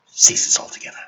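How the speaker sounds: dramatic whispered hush. Acted. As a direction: breathe it out as the guns stop.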